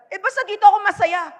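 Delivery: high, loud and fast, a stubborn spouse digging in; stubborn insistence. Retold or imitated. A stubborn spouse insisting to the pastor, loud and fast. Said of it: "eto pa another".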